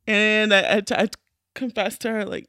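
normal talking voice

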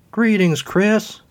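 high, grand goddess greeting; a high, stately goddess voice. Acted. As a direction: greet him like a lofty goddess. Goddess makes her entrance.

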